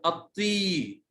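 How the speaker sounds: أطي with the final ee held long; slow teacher's model of long-vowel أطي. Shown as a demonstration. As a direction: stretch the ee of أطي slowly. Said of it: "صوت طويل مع ال".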